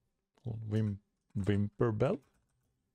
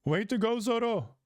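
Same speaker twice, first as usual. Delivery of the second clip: high cheerful praise; high bright cheering voice; excited cheer; 10 semitones higher than usual. Acted. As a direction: cheer him on like a fan. Cheering for Zoro.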